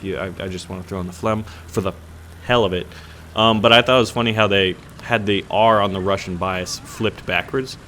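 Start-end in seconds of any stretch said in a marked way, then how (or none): none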